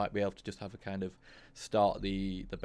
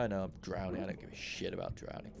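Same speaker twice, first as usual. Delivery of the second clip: usual speaking voice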